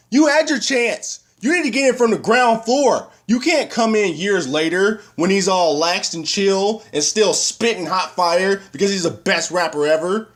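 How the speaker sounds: high-pitched indignant tirade; mock outraged scolding. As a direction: lay down the law to mom, comically exasperated. Acted scolding of mom, jokingly.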